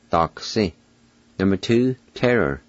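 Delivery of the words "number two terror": ordinary talk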